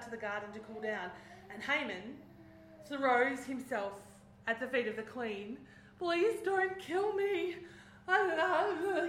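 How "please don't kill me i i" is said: high, loud, slow pleading; begging for his life. Retold or imitated. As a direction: beg desperately for mercy in a changed voice. Haman begs at queen's feet.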